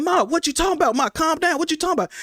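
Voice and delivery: high, urgent questioning; retold panic. Son on phone with dying mother, alarmed.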